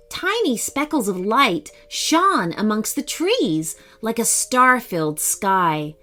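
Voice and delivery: ordinary delivery, nothing marked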